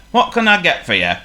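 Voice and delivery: high, loud cheery northern shopkeeper. Acted. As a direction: boom it out like a jolly shopkeeper. Shopkeeper character voice.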